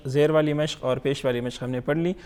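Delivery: usual speaking voice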